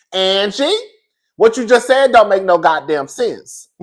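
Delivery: loud, slow, high call-out to Angie; mock scolding. Scolding Angie on TV for her story, loud and slow. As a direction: spell it out slowly and loudly, exasperated.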